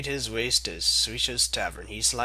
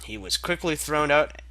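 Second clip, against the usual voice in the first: usual speaking voice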